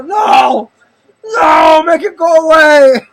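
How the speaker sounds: mock terrified. Peter on the floor begging Jesus, mock terrified shout. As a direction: shriek like you're begging.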